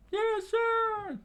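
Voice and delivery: very high falsetto squeal; eager little-kid yell; screamed boys' reply. A narrator's reading. Boys answering the order to protect her, screaming. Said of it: "they screamed out as Vivi watched".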